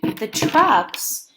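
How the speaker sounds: put-on slow, even read-aloud for young listeners; reading to children. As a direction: read each sentence to the class with care. Teacher reads book to class.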